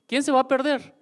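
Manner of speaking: pitched high, teasing "who would miss it"; mock-incredulous rhetorical jab; challenging question. Real, not acted. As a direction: dare the crowd to answer.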